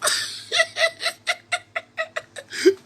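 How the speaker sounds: breathy high-pitched cackle; shrill laughing fit. Real, not acted. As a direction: burst out in a squeaky laugh. Laughing at people.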